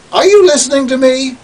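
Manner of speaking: loud, fast, high demand. Acted. Demanding the listener pay attention, loud and high.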